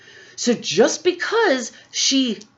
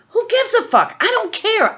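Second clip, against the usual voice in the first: much higher, angry exclamation; exasperated outburst; 12 semitones higher than usual. Real, not acted. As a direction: explode in disgust.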